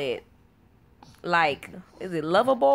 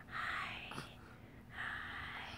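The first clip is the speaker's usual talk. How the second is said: put-on barely audible baby whisper; imitated whisper; 25 dB quieter than usual. Mom copies her whispering baby, whisper. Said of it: "He over here whispers my".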